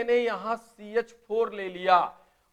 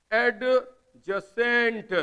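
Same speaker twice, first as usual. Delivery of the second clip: adjacent stretched out very slowly, syllable by syllable; drawn-out word; much slower than usual. Shown as a demonstration. Teacher to students, stretching the term slowly. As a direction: spell out adjacent slowly for the class. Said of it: "तो शब्द सुनना".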